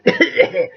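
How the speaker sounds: a little acted splutter; mock spluttering cough. Playing the whale choking for the children, mock splutter. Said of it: "and she spluttered".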